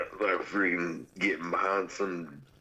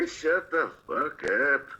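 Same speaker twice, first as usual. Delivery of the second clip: slow, higher mock Southern snap; 6 semitones higher, slower than usual. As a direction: slip into a rough backwoods drawl to tease. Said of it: "Code switching".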